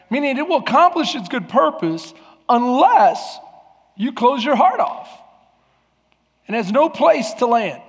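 high, loud preacher's call to the congregation; full-force preaching. Acted. Pastor urging the congregation, full-force preaching. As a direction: preach it out to the whole room.

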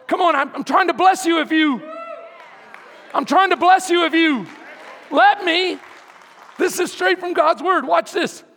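loud fervent sermon; preaching at full force. Acted. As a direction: build it up and preach.

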